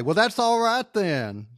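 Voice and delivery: mock the villain's smug catchphrase; quoting the villain. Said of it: "I'm just going to come out and say".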